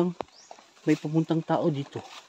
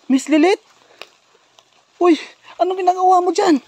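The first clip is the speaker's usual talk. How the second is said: high, loud disbelief; startled discovery; 11 semitones higher, 6 dB louder than usual. Acted. Finding the missing Miss Lilith, startled. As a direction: call out in alarmed surprise.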